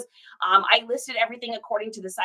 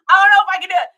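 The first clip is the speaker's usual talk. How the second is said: loud exclamation; 6 semitones higher, 9 dB louder, much faster than usual. Real, not acted. Can't face reading it.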